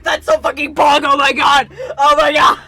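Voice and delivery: loud, thrilled shouting; excited yelling. Real, not acted. Streamer reacting to the reveal, loud and thrilled. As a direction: yell it out, still buzzing. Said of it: "I get so excited".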